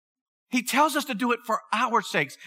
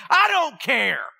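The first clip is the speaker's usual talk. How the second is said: higher, defiant outburst, not a full shout; retold anger; 6 semitones higher, 4 dB louder, slower than usual. Answering God's verse while furious, retold angry retort. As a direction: throw it back at God, mildly mad. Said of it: "so mad you wouldn't believe it".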